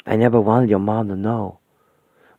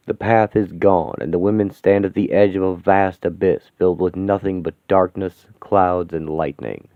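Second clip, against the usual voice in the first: story narration; 7 semitones lower than usual. A narrator's reading. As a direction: set the scene with weight. Narrator scene description.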